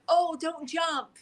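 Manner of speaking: high, slow pleading "Oh, don't jump"; a hammed-up high, drawn-out "Oh, don't jump"; mock plea. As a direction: mimic a frantic rescuer begging. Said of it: "when you say things like".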